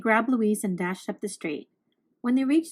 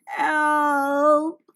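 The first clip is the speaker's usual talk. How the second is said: sniffling help; 4 semitones higher, 4 dB louder than usual. A narrator's reading. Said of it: "she sniffled".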